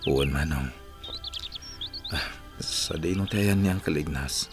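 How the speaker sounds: faint, soft injured voice; weak, wounded. Acted. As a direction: speak gently, conserving strength. Wounded man asking from his bed. (nakapsut a timek ti masakit)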